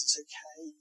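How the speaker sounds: soft, hushed reassurance; gentle whisper. Pretend. Jesus comforting someone woken from a scary dream, softly hushed. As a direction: murmur it close, like comforting a frightened child. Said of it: "and he whispers".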